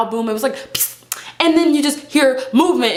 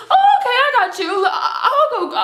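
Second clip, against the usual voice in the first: high, quick mock helpfulness; hammed-up nervous "sure thing"; overeager agreement; 10 semitones higher than usual. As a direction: agree in a rush, pitched way up. Acts eager reply to man.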